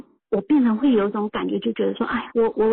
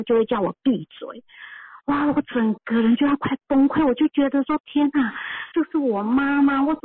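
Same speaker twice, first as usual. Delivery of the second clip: ordinary talk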